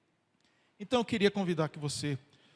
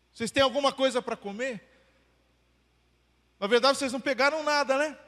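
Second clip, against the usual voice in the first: faster, louder playful jab as Jesus; teasing rhetorical question; 7 semitones higher, 8 dB louder, much faster than usual. Pretend. Pastor voicing Jesus teasing the fishermen, playful and quick. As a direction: rush the friendly tease, expecting a no. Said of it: "como se Jesus estivesse falando assim".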